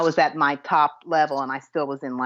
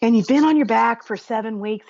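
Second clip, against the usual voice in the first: ordinary delivery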